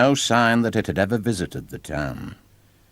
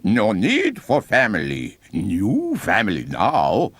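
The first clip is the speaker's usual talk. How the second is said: creepy clown; 4 semitones higher, 3 dB louder than usual. Acted. Clown answers the captured boy.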